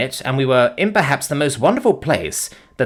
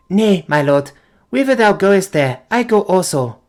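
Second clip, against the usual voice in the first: put-on high, earnest girl's voice; devoted young girl; 9 semitones higher, 5 dB louder than usual. Foulata tells Good she will follow him, earnest and high. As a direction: plead to stay at his side.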